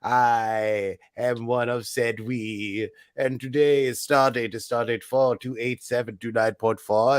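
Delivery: over-the-top mock British presenter; British accent. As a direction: play a pompous British host welcoming listeners. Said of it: "See how I move flawlessly between accents".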